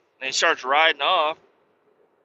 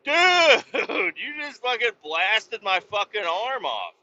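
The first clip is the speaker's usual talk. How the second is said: much higher, louder, slow hammed-up panic with a cry, into laughs; panicked shriek; 9 semitones higher, 3 dB louder, slower than usual. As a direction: shriek at the man who shot you. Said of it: "I'm like".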